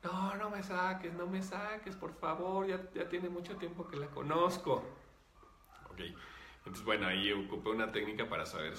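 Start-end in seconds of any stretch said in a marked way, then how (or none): 0.0-4.8 high-pitched childlike begging, imitated; little girl's voice. Spirit begs healer to let it stay, imitated little-girl plea. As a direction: beg like a small girl in a mock high voice. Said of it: "gritar con una voz de niña".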